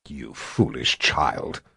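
low, slow growl; wicked stepmother. Acted. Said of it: "Sister Alicia growled".

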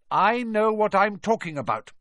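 high, curt put-down; short. A narrator's reading. McGonagall silences Harry's protest, curt. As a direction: cut off the objection shortly. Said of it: "she said shortly".

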